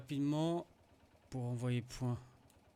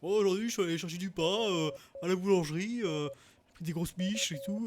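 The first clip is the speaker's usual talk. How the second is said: higher mocking storyteller voice; mocking diary voice; 4 semitones higher, 5 dB louder than usual. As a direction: parody a rambling diary entry. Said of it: "Le mec, il écrit sa vie".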